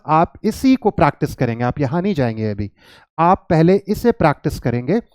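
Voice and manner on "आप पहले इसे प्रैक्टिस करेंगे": usual speaking voice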